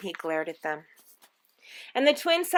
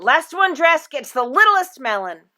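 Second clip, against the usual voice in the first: playful challenge; 6 semitones higher, 9 dB louder than usual. A narrator's reading. Father playfully challenging daughter.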